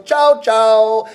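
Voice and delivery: "Chow, chow" tossed off lightly, a breezy no-no; light double chow. Shown as a demonstration. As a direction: wave it away lightly with "Chow, chow". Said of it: "If you're saying a little bit lightly".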